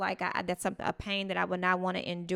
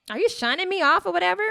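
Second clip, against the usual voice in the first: high, loud, put-out question; her own indignant question; 10 semitones higher, 7 dB louder than usual. Retold or imitated. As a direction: ask high and offended, mock-hurt. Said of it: "And I'm like".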